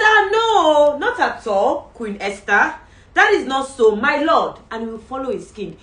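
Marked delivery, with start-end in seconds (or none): 0.0-4.6 high, loud protesting courtier; pleading courtier. Acted. Haman denies to Queen Esther, high loud pleading. Haman's ideal reply acted.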